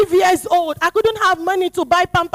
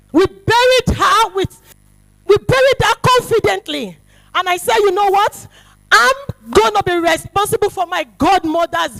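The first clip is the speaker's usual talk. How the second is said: loud, raised testimony; preaching; 3 semitones higher, 5 dB louder than usual. Acted. Preacher testifying about her godmother's burial, loud. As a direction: declare it boldly.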